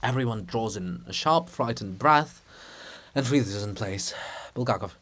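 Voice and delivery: ordinary delivery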